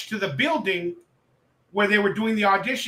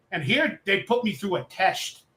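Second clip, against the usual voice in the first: normal talking voice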